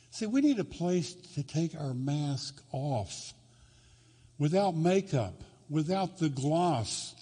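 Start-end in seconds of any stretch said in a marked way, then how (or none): none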